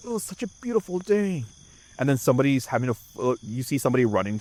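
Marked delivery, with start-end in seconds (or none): 0.1-1.4 mock contented tree. Tree enjoying the day.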